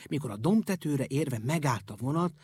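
ordinary delivery

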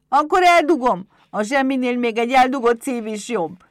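high, very loud imp voice; defiant kobold. Acted. Pumukli threatens to hide the heart, high defiant shout.

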